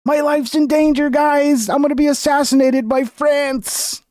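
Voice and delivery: high, hammed-up alarm about a fake threat; mock panic. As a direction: whine it like a drama queen in danger. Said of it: "you gotta walk away and be like".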